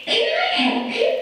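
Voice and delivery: very high, squeaky squirrel; tiny high-pitched squirrel offering help. Acted. Squirrel voiced in story.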